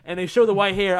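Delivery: usual speaking voice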